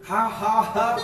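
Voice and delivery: a burst of laughter with spaced ha ha ha; demo laugh. Shown as a demonstration. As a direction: show them how to laugh for no reason. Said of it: "start laughing".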